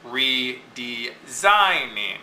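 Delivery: redesigning slowly by syllables, SIGN stressed; slow redesigning, hitting sign. Shown as a demonstration. Teacher sounding out the meter, slow redesigning with SIGN stressed. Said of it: "Notice it's unstressed".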